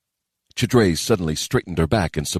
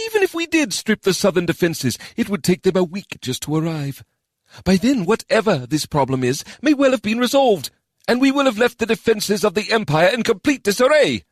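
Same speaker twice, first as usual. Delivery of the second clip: dismissive minister. Acted. Minister argues with council.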